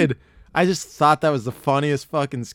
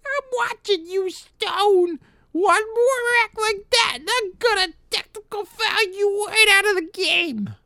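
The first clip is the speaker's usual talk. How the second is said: put-on far higher, squeaky old man; Whitey voice; 4 dB louder than usual. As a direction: scold like a tiny cranky old referee. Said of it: "It's Whitey's like".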